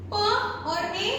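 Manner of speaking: very slow, "प और ए" each sound apart; sounding out letters for kids; sound blending. Shown as a demonstration. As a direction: stretch out प and ए separately. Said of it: "ई का मतलब होता है ए".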